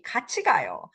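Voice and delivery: friendly invitation with a falling end; friendly proposal. Shown as a demonstration. Teacher shows 같이 in front, friendly suggestion. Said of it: "then it sounds very friendly".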